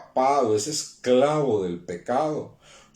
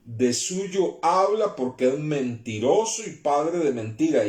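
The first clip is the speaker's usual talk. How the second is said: forceful scripture reading; 3 semitones higher than usual. Acted. Preacher reading Jesus' rebuke to the accusers, forceful. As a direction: proclaim Jesus' rebuke to the crowd.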